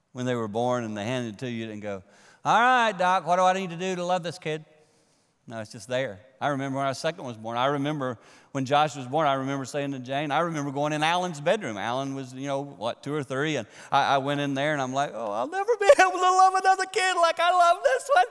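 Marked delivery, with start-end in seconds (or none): 2.4-4.7 louder, higher put-on question to the doctor; mock eager parent. Mock new parent asking the doctor. 15.1-18.3 hammed-up high, loud wail; mock overwrought. Retells his own overwrought reaction.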